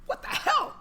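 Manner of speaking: airy, voiceless exclamation; startled whisper. Retold or imitated. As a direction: whisper in alarm at a sudden scream. Reacting to his kid's scream.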